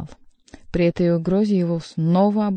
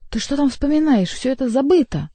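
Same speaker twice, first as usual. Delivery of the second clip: high, indignant protest; agitated, higher-pitched rebuke; hissed; 7 semitones higher, 4 dB louder than usual. A narrator's reading. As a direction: object sharply, pitch jumping up. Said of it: "прошипел Генрих".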